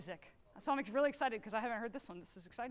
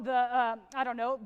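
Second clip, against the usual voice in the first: normal talking voice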